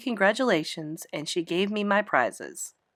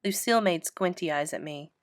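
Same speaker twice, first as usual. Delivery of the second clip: ordinary delivery, nothing marked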